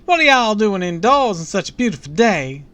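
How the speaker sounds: high, booming Southern belle; chipper Southern belle. A narrator's reading. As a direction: boom it out as a very chipper Southern lady. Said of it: "let's give her the Southern".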